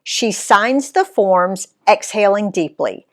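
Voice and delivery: plain reading voice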